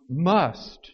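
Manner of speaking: must punched loud and falling; stressed must. Shown as a demonstration. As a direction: hit the word must hard. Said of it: "he uses the word".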